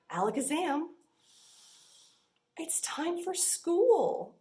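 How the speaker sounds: magic reveal. Acted. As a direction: reveal the page like a trick. Magic word page turn.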